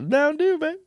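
put-on squeaky high hype call of Mountain Dew, baby; mock catchphrase. Co-host echoing last week's joke line, high and playful.

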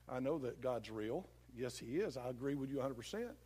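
low, soft, reasonable tempter; agreeable devil. Acted. Devil pretending to agree with a believer, low and soft. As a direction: agree quietly to win trust. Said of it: "he comes to you and he goes like this".

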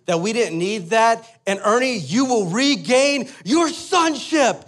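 high, slow mock-heroic oration; imitating a buddy's epic pep talk; rousing proclamation. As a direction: build to a grand, stretched-out finish.

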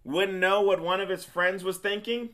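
worked-up teasing challenge; mock disbelief. Real, not acted. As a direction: rub in how obvious it was.

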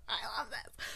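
delighted whisper; hushed, giddy whisper. Real, not acted. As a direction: whisper it like a secret. Whispered repeat.

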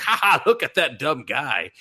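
high, laughing put-on voice; laughing mockery. Ghost dad laughing at his own son, mocking laugh. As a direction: cackle at the dumb guy.

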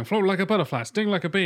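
mock movie-star version of the boxer line. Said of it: "play [name] going".

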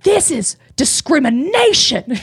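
high, drawn-out mock outrage; retold screaming. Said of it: "she's screaming this at me now".

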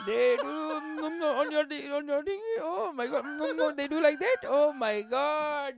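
high accented mock moaning wonder; overexcited listener. Local picturing the visitor's sex story, overexcited mock wonder. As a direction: stumble and hum through hammed-up amazement. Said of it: "Like, they just start".

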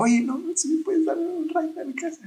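high, put-on voice of the ex; retelling how the ex asked for a ride, high mock voice; imitating the ex asking. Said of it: "Y me dijo".

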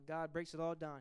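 normal talking voice

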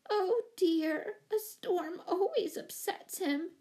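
high, drawn-out lament; moaning. A narrator's reading. Pet owner lamenting her monkey's upset, moaning. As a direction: wail it gently, pitched well up. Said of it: "moaned Miss Giddings".

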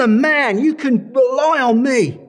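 loud, high, confident promise; bold boast. Retold or imitated. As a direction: boast it out proudly. Peter's boast, quoted.

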